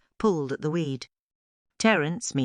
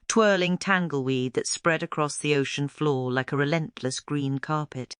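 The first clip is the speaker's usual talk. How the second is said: ordinary delivery, nothing marked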